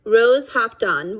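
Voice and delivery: usual speaking voice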